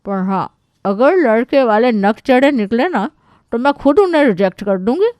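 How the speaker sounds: high, loud aunt voice, laying down terms; bossy aunt. Acted. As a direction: play the headstrong aunt issuing a warning. (दबंग बुआ की ऊँची आवाज़ में)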